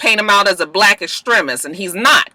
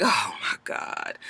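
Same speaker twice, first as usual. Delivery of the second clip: slow, whispered dismay; whispered oh-my-God dread; breathless whisper; 8 semitones lower, 12 dB quieter, slower than usual. Retold or imitated. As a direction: breathe it out in shock. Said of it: "prosecution is looking like".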